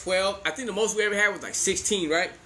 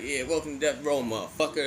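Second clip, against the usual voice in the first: mock tough guy; 7 semitones lower, 4 dB quieter than usual. Kids playing Death Row tough guys.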